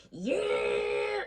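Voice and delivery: long, drawn-out mock honking yell; honking driver. Other car honks at the stop sign, long imitated blare. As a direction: imitate a car horn with a held-out cry. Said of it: "This fucking car starts honking".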